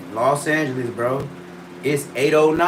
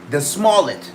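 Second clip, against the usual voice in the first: ordinary talk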